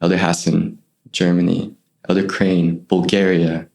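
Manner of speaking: low, solemn Australian announcement; Australian accent. Retold or imitated. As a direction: read the names out in a low Australian voice. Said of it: "big, mean Aussie".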